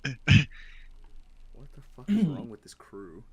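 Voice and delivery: slow exasperated drawl. Pretend. Mock exasperation at crew.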